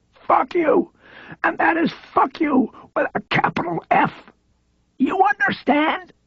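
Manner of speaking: high, strained, mocking comeback; frail high voice, defiant; frail defiance. A narrator's reading. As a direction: throw his words back at him. Frail man mocks agent back.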